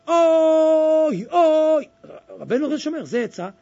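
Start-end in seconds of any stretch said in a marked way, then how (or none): 0.1-1.9 long, loud, high-pitched "oy"; crying out. Shown as a demonstration. Preacher showing listeners how to cry out at the grave, crying out. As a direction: draw out a cry of woe at full voice. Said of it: "לצעוק".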